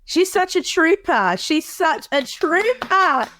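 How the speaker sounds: usual speaking voice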